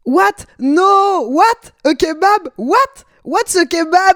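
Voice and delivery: high, loud baffled what?; baffled shock. Retold or imitated. A guy baffled by the kebab comparison, high loud disbelief.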